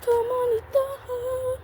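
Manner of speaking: high, slow coaxing plea; high pleading voice. Real, not acted. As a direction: beg the fish sweetly to bite. Pleading with fish to bite.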